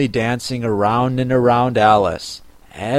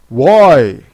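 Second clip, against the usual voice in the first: loud, lightly voiced creature; Gryphon; 7 dB louder than usual. A narrator's reading. Gryphon answers Alice's question, loudly.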